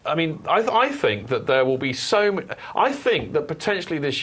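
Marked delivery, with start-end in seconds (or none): none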